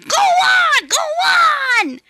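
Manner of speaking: high, loud squealing no; shouting trucks. Acted. Trucks refusing Thomas in a high loud shout. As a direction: shriek defiantly like naughty trucks.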